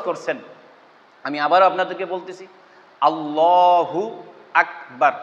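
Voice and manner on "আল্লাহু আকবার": আল্লাহু stretched slowly, then a short আকবার with a hard k; correct takbir, drawn out. Shown as a demonstration. Teacher repeats the correct takbir slowly for students. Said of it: "এটা হলো সঠিক উচ্চারণ".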